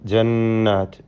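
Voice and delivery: ജന്നാത്ത് with a held, humming nn; nasal doubled noon. Shown as a demonstration. Teacher shows students the doubled noon, ജന്നാത്ത് hummed. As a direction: hum through the nn of ജന്നാത്ത്. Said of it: "നൂൻ മുഷദ്ദദ മണിച്ചുകൊണ്ട് ഉച്ചരിക്കുക".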